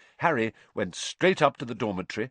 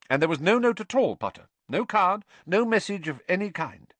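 high, louder, pressing questions; stern professor; 11 semitones higher, 5 dB louder than usual. A narrator's reading. Teacher questioning a pupil about an anonymous gift, pressing. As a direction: interrogate the pupil in a raised, high voice.

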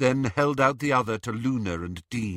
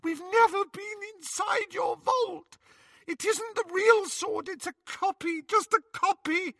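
sobbing pleading. Acted. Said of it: "Hermione sobbed".

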